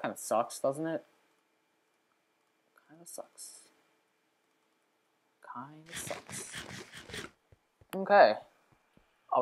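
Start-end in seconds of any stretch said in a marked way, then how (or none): 2.9-6.5 very quiet, half-whispered grumble; barely audible complaint to oneself; muttering. Real, not acted. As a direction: mutter it under your breath. Muttering to himself over lost food.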